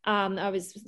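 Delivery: normal talking voice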